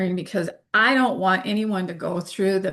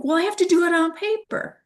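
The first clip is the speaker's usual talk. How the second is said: quoting herself; 11 semitones higher than usual. Retold or imitated. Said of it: "I'm like".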